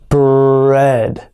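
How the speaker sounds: Fred said slowly with the R stretched; slow broken-down word. Shown as a demonstration. Teacher breaking down the tongue twister slowly. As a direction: draw out Fred sound by sound. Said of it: "break the tongue twister down".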